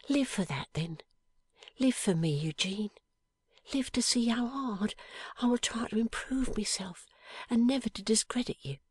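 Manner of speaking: lightly voiced young wife, tender and earnest; a hint of Lizzie. A narrator's reading. Young wife answering her dying husband, gently earnest.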